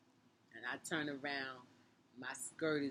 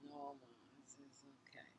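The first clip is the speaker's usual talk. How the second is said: very soft, near-whispered reply to mom; retold meek reassurance; 13 dB quieter, faster than usual. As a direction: reassure her almost without voice. Retold tearful child reassuring mother.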